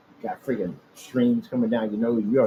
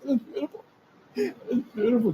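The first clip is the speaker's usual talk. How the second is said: awed hater; 10 semitones higher than usual. Retold or imitated. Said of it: "They'd be like".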